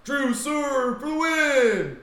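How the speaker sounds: high, loud buzzer-beater call; announcer call. Retold or imitated. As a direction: shout it like a hyped announcer. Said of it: "I'm like".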